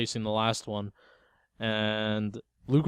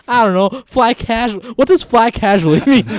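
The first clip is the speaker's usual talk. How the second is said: high, loud, incredulous and amused; loud hammed-up puzzlement breaking into laughter; mock bafflement; 11 semitones higher, 13 dB louder than usual. Mocks Han's odd instruction, laughing.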